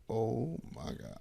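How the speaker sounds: slow, hushed and stunned "Oh my God"; dazed freshman, barely audible; shell shocked. Retold or imitated. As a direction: mouth it like the wind was knocked out of you. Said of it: "So I'm shell shocked".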